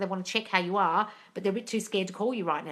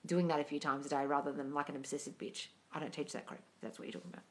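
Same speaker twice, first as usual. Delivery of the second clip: usual speaking voice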